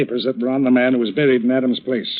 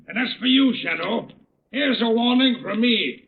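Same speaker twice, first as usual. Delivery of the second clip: menacing threat; 8 semitones higher than usual. Acted. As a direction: warn him off with rising menace. Kelvin threatens the Shadow.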